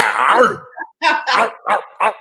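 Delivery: hammed-up pit bull growls, rising high. Shown as a demonstration. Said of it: "you gotta be that pit bull".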